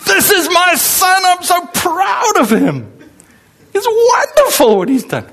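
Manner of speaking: put-on a poor old father bursting with pride; proud father.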